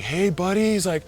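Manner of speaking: high, loud choked greeting; emotional friend. Retold or imitated. Tearful friend greeting the rescued surfer, high emotional. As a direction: greet him with relief.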